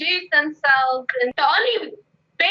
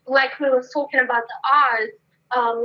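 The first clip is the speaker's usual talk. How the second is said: ordinary talk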